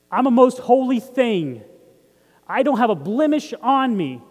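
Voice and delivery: congregation repeats it loud and slow; loud, slow declaration. Shown as a demonstration. Pastor leads the church to declare it out loud. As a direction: proclaim it big and slow, like a creed. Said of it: "What are we saying?".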